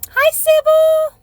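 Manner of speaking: high, stretched-out greeting; sing-song hello. Real, not acted. Greets viewer on live stream.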